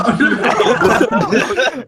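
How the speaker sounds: high, helpless cackling laugh; burst of laughter. Real, not acted. Reacting to co-host's shout that Alex is dead, cracking up. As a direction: crack up at the joke.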